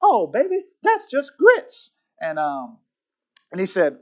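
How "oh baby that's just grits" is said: high, drawn-out Southern waitress voice; slow, loud Southern belle impression; very Southern accent. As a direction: coo it in a broad Southern accent. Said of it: "in her very southern accent".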